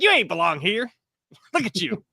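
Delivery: mocking snooty neighbor, laughing through it; mocking rich neighbor. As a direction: sneer at the scruffy kids while cracking up. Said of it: "you're like".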